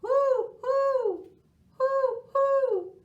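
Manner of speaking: high, loud drawn-out hoots; loud sustained owl calls. A narrator's reading. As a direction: hoot like an owl. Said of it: "called Owl".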